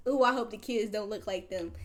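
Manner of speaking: ordinary talk, nothing marked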